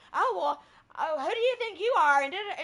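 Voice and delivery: hammed-up offended comeback; defensive retort. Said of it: "she got defensive and goes".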